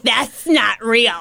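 slow, drawn-out mock objection; mocking gatekeeper. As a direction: mimic a gym gatekeeper, dragging each word. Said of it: "be like".

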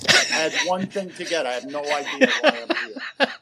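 confused shopper voice. Retold or imitated. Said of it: "I stand in the aisle at Kroger and go".

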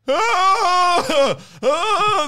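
high, loud scare-off yell; a sharp, high, loud yell; yelling at a bear. Retold or imitated. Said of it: "just yell at the bear".

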